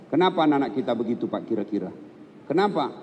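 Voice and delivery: ordinary talk, nothing marked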